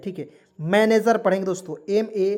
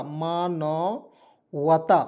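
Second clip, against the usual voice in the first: मानवता very slowly, split into drawn-out parts; syllable by syllable; 5 semitones lower, much slower than usual. Shown as a demonstration. Teacher teaching a Hindi meaning to learners, syllable by syllable. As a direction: stretch मानवता out, pausing between syllables.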